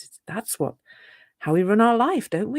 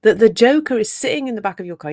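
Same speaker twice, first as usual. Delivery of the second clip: usual speaking voice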